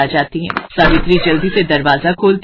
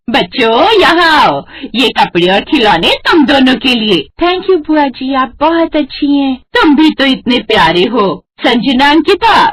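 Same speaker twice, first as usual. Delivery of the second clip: put-on higher, louder bossy old aunt; loud complaining aunt; 6 semitones higher, 3 dB louder than usual. Aunt complains to sister-in-law about her nephew, loud. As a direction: scold about the late nephew, loud and high.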